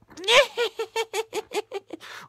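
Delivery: high, shrill-free mock witch cackle, way up; cliche witch cackle. Mocking the movie witch's cackle to viewers, cliche witch cackle. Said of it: "The cliche witch laugh?".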